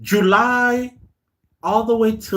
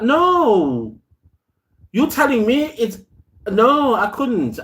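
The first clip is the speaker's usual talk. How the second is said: exasperated protest; 7 semitones higher than usual. Retold or imitated. Recalling packing the tree away.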